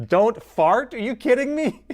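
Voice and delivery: high, loud disbelief; incredulous laughing outburst. Real, not acted. Streamer reacting to a chat joke, high and loud. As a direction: shriek it in disbelief.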